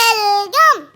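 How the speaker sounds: loud barked command; sharp shout. Real, not acted. Gamer shouting at his character, sharp loud order. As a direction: snap it at the screen.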